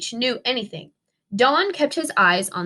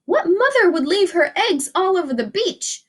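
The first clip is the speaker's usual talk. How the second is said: high, put-on indignant mother; mother pteranodon voice; 6 semitones higher than usual. A narrator's reading. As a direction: voice the concerned mom. Mother indignant at abandoned eggs.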